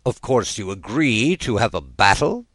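ordinary delivery

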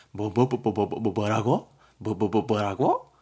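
comic stutter bursting into a rising question; hammed-up machine-gun stammer of outrage; stammering indignation. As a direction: splutter in mock disbelief. Acts stammering, indignant disbelief.

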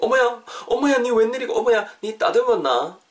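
high-pitched, exclaiming surprise; acted astonishment. Teacher playing a friend who spots trimmed eyebrows, acted astonishment. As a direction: gush in mock shock at a friend's new look.

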